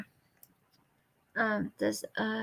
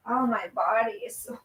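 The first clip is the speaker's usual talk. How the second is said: ordinary delivery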